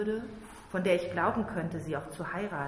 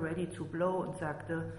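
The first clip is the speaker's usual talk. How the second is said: plain reading voice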